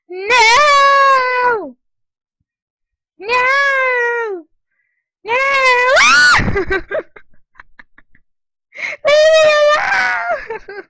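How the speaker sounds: high, drawn-out panicked screams; screaming. Real, not acted. Gamer being chased in the game, panicked screaming. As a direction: scream it out, holding the words long. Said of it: "I'm screaming so much".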